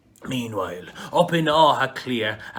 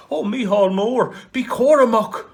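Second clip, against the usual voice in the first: high, loud warning cry; warning call; 9 semitones higher, 8 dB louder than usual. Acted. Villagers warn Micheál, loud high call.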